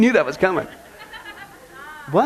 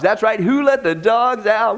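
normal talking voice

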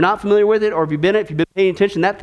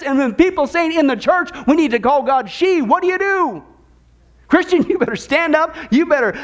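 preaching at full force; 9 semitones higher than usual. Acted. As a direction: lift it to a fiery climax. Peak of sermon, preaching hard.

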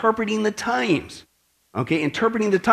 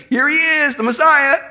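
raised, higher announcing voice; mock heralding; 6 semitones higher, 4 dB louder, slower than usual. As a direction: herald it as if pointing him out. Acting out announcing the Messiah's arrival.

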